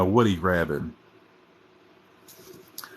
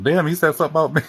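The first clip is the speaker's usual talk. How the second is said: fast, louder mock Tyler Perry reaction; quick, higher "Damn" of surprise; imitating Tyler Perry; 8 semitones higher, 5 dB louder, much faster than usual. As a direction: rattle it off like a startled Tyler Perry. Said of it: "He'd be like".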